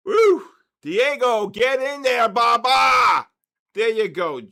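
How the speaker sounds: loud, high triumphant shouting as the market jumps; roaring celebration at the screen; ecstatic cheering. Real, not acted. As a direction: yell it out like your team just scored. Cheering the market move again.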